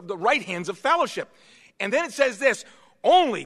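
loud, high-pitched pulpit emphasis; forceful preaching. Real, not acted. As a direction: drive the key words home to the congregation.

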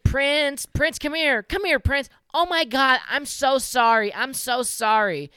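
high, loud wailing imitation of the owner; hammed-up calling that slows into drawn-out apology; mock frantic owner. Owner chasing her dog and apologizing, mock frantic wail. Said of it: "She's like".

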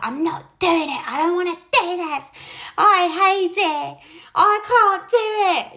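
put-on high, loud toddler wail of refusal; imitating crying toddler. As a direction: play the scared three-year-old who won't go on. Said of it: "and she's crying".

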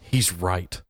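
low hushed confession; mock-secret aside; secret whisper. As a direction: lean in and tell a secret. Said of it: "Can I tell you a secret?".

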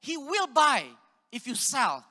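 the boss firmly rebuts him; boss's loud retort. Retold or imitated. Boss scolds the young salesman, loud mock shout. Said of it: "He said".

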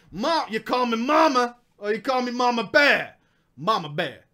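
put-on Southern mama voice; southern accent. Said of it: "I'm gonna give her the southern accent".

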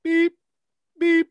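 two high, loud phone beeps; imitated double beep. Friend answers no by phone key, imitated double beep. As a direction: mimic a keypad tone twice, high and loud. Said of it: "two beeps for no".

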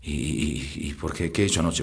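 low, soft, halting question; sheepish asking. Acted. Hungover father asks his son about last night, sheepish and hushed. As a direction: ask it timidly, stumbling on the first word.

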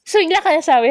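ordinary talk

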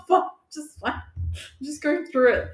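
high strained voice, then slow drag; mock brave. Kid insisting to family she's fine, high then slowed down. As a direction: pretend to be fine while falling apart.

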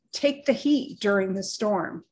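normal talking voice

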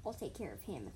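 low, menacing undertone; dropped, hostile voice; low hiss. A narrator's reading. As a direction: promise it as a threat. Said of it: "Flame Paw hissed".

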